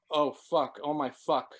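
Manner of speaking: ordinary delivery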